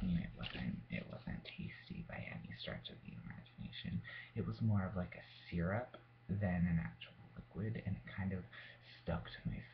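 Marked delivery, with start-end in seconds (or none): 0.2-4.0 whispered. Real, not acted. Disgust at the liqueur, whispered.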